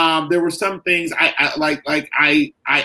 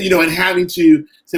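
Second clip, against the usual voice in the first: ordinary talk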